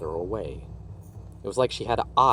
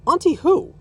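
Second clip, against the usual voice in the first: puzzled shout; 11 dB louder than usual. A narrator's reading.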